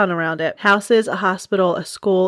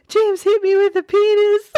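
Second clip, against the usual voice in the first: put-on high, wailing little kid voice, much higher than her own; crying little boy; 13 semitones higher than usual. Mom imitating the crying boy who got hit, high child wail. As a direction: squeal it like an upset five-year-old. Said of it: "comes running to me crying".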